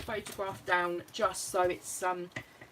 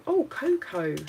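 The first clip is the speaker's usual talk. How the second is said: excited; 8 semitones higher, 10 dB louder, slower than usual. Real, not acted. Delighted at finding cocoa sticker.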